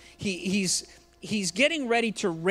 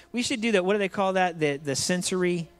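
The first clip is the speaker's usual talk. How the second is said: ordinary talk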